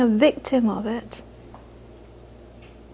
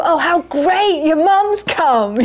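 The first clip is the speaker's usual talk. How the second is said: slow, higher gushing delight at the mum visiting; gushing churchgoers; 5 semitones higher, slower than usual. Retold or imitated. Hosts fussing over the teacher's mother, mock delight. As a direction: coo it like delighted hosts greeting a guest. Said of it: "they thought".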